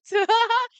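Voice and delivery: sudden high laugh; loud burst of laughter. Acted.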